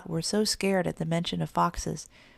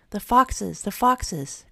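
louder, startled storybook cry; alarmed cry; 3 semitones higher, 4 dB louder, much slower than usual. A narrator's reading. Three little kittens hear feet behind them, alarmed cry. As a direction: call out a warning in alarm. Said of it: "cried the three little kittens".

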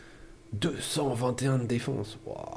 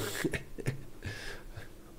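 breathy laugh; 10 dB quieter than usual. Real, not acted. Laughs at own joke.

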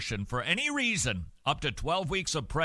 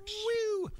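very high whooping woo; mock ride whoop. Recalling the drop ride at Valley Fair, a high whoop. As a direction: whoop like you're dropping on a ride.

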